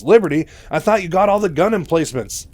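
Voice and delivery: high, loud mock astonishment; all surprised. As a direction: act out a startled accusation. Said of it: "Raynor, all surprised".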